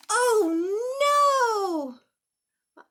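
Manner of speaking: loud high dismayed cry. Acted. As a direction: react with big dismay. Kites fail, dismay.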